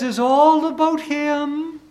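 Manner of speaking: mock high woman's voice; high-pitched wife. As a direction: savour it like the delighted wife. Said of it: "in her high-pitched voice".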